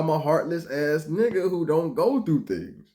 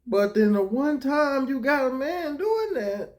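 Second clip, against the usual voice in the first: normal talking voice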